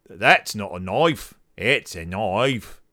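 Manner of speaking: low, slow Australian drawl; slow, deep put-on Australian voice; Australian accent. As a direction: ham up a broad Aussie accent. Australian film catchphrase impression.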